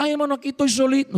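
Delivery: high, fast mock whine; a hint of a whimpering church sister; imitating a lonely sister. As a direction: lightly mimic her self-pity. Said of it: "Porque siempre me decía".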